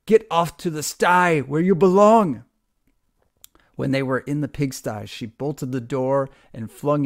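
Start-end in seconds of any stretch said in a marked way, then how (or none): 0.0-2.4 loud, high furious yell; furious calling after. A narrator's reading. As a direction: drive them out with a furious cry. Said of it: "she called after them".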